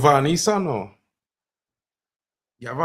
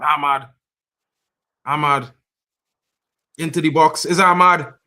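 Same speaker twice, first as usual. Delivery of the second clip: louder, urgent live match call; excited play-by-play; 3 semitones higher, 7 dB louder than usual. Real, not acted. Watcher calling Ahmad into the box, excited. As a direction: lift the volume as the attack builds.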